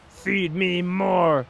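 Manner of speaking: slow, drawn-out mock growl of the catchphrase; hammed-up slow wrestler chant; Ryback catchphrase. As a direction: stretch it out like a wrestler hyping the crowd. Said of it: "saying to the crowd".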